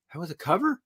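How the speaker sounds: high disbelieving question; surprised fan. Retold or imitated. Fan learning it was a cover, high surprise. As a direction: sound caught off guard. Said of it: "They go".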